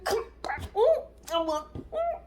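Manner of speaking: high, strained imitation; mock buddy greeting. Woman mimicking the girlfriend tapping him, mock buddy greeting. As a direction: mimic her in a high pitch with little grunts.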